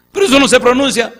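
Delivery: high, loud, quick protest; Moses objecting. Acted. Moses protesting loudly to God about the name. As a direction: blurt out the objection.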